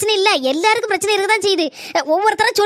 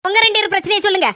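normal talking voice

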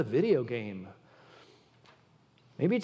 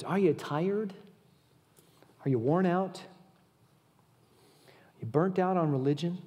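hushed comforting read; gentle scripture reading. Acted. Reads gentle scripture paraphrase.

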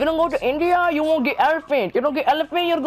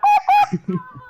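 high laugh; 10 semitones higher, 3 dB louder than usual. Real, not acted. Laughing after his voice cracks.

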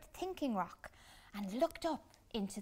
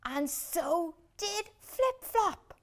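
slow, higher, louder with gasps; astonished gasps; gasping in surprise; 10 semitones higher, 6 dB louder, slower than usual. A narrator's reading. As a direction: gasp as he lifts off. Balloon lifts him up.

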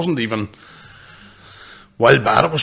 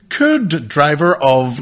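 slow, louder mock intercom call; intercom announcement; 5 semitones higher, 5 dB louder, much slower than usual. Stadium intercom calling a driver, slow tannoy voice. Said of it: "we got through the intercom".

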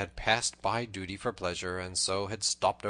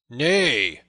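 loud, higher bold denial; 4 semitones higher, 13 dB louder than usual. A narrator's reading. Tanner answers Little John's accusation, bold and loud. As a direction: refuse to be cowed. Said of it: "quoth the tanner baldly".

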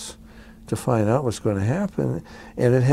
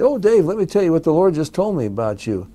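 lightly mimicked eager messenger, higher pitch; higher, mildly mocking imitation of a believer; eager prophecy-giver; 6 semitones higher than usual. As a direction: imitate someone gushing with news from God. Mimics people bringing him messages.

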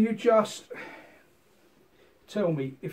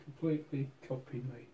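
hypnotic; 3 semitones lower, 9 dB quieter than usual. Acted. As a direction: draw her into a trance softly. Hypnotic instruction to copy movements.

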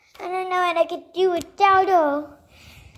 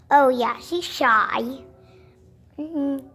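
usual speaking voice

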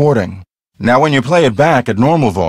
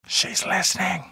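soft, eerie hushed warning, much quieter; spooky hush; 7 semitones higher, 11 dB quieter than usual. Acted. Warning the visitor the ghost hears, eerie hush.

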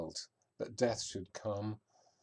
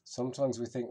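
normal talking voice

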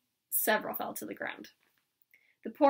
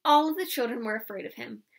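ordinary delivery

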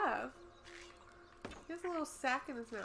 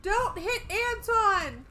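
pleading shout; 10 semitones higher, 10 dB louder, much slower than usual. Real, not acted. Pleading about pet ant.

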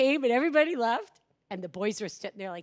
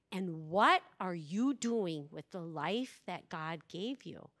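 gentle, earnest counsel; 4 semitones lower than usual. Retold or imitated. Retreat leader to crying boy about his parents, gentle earnestness. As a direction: let each phrase land with long pauses.

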